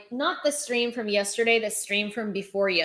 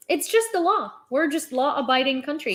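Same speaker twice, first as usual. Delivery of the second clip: mock innocence; 6 semitones higher than usual. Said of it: "They're like".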